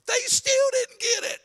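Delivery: very high, slow, loud disbelief; incredulous high-pitched exclamation; exasperated high cry. Real, not acted. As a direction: cry it out high, stretching each word. Preacher exasperated at oblivious disciples.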